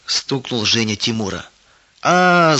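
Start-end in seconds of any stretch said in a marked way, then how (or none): 2.0-2.6 short high yelp of pain; child's yelp. A narrator's reading. Little brother yelps at his sister, high and loud. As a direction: cry out sharply when hit. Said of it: "завопил Тимур".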